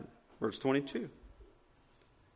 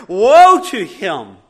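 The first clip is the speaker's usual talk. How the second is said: slow, much louder warning; solemn proclamation; 5 semitones higher, 18 dB louder, slower than usual. Acted. Pastor reading Jesus' warning to the church, solemn loud proclamation. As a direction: pronounce doom slowly.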